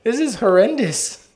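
high, shocked outburst; appalled doctor voice. Acted. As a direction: play a horrified doctor. Voicing appalled later doctors.

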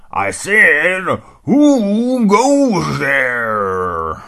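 put-on loud, slow, booming old hound; old dog's challenge. Old hound dog calling out at the unseen intruder, booming and slow. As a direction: call out into the dark, very slow.

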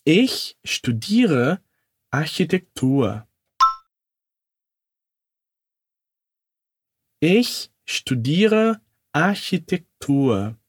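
slow, careful German sentence; slow model sentence. Shown as a demonstration. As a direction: say it slowly for students to repeat. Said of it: "Repete comigo". (frase lenta e didática)

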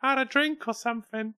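very high put-on Scouse. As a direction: let the Liverpool accent climb into a squeak. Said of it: "it's getting higher and higher and higher".

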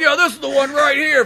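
high, loud eager helper; eager clerk. Retold or imitated. Clerk showing fan the movie, eager and high. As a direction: hand it over eagerly.

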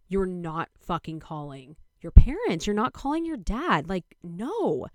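forbidding shout. Retold or imitated. Boyfriend refusing to let her call her dad, loud forbidding yell.

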